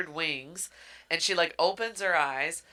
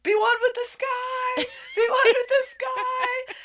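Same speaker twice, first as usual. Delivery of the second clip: high, loud call from far off; distant shouting; 6 dB louder than usual. Retold or imitated. Rain calling to her as she falls. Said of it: "And she's like".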